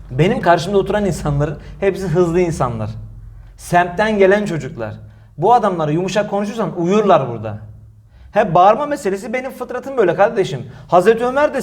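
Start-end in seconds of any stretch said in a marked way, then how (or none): none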